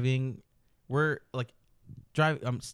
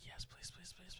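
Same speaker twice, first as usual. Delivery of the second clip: whispered begging, breathless with eagerness; a pleading whisper to himself; eager whisper; 7 semitones higher, 23 dB quieter than usual. Retold or imitated. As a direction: hiss it like a secret wish. Begging to drive drunk girls home.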